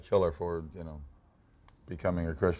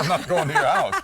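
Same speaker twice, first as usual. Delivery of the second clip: laughing quip; 7 semitones higher, 7 dB louder than usual. Retold or imitated. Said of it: "And I go".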